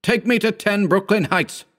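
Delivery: high, urgent order; shouted. A narrator's reading. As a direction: call out the address urgently, pitch up. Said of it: "I shouted".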